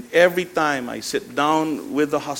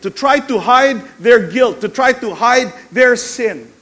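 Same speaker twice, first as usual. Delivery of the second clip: high, loud, slow sermon declaration; drawn-out emphatic preacher cadence; forceful preaching; 7 semitones higher, 7 dB louder, slower than usual. Acted. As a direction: hammer each phrase to the congregation.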